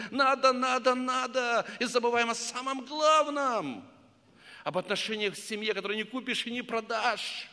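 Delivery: a father weeping, crying out to God; anguished prayer. Retold or imitated. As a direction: pour out grief to the Lord.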